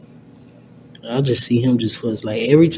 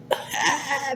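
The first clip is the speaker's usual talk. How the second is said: high giddy laugh; 4 dB quieter than usual. Real, not acted. Hyping himself up on thighs.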